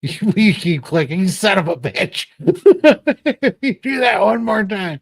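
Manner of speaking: high, loud playful scolding through laughter; laughing mock threat. Host teasing co-host over clicking, laughing mock threat. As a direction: threaten them while cracking up.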